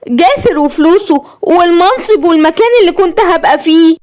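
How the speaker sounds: very high, unhinged listing of losses; hysterical rant. Acted. Ruined wife counts everything she lost, hysterical. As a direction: spiral into madness. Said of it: "وقالت بهستيريا".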